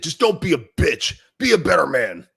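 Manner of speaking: punchy mock telling-off; mock harsh rebuke. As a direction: act out chewing a man out. Imagined harsh rebuke to struggling father.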